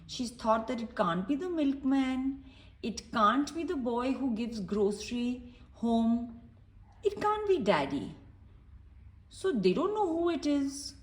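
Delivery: usual speaking voice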